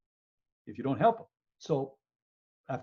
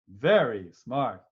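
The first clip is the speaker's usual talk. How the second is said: slow, louder mock praise; sarcastic praise; 5 semitones lower, 6 dB louder, much slower than usual. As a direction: drag it out sarcastically. Mocking the wrong triage choice.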